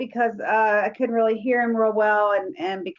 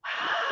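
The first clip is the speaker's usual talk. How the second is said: heavy breathy exhale, imitated; breathing in ear; 7 dB quieter than usual. Retelling a spirit in the bathroom, imitated breathy exhale. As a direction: breathe out like someone at your ear. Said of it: "this guy's going".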